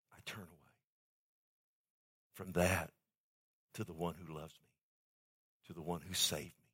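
whisper-soft resolve, turning away from sin; hushed vow. Acted. Believer vowing to God that he turns from sin, hushed and low.

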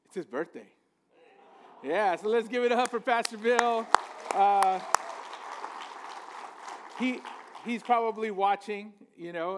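ordinary talk

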